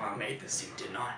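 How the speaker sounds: whispered movie line. Acted. Repeating the quote in a whisper.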